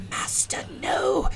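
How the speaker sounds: whispered, slow kobold cry of grief; a small creature's hushed wail for its master. Acted. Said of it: "You see the kobold go".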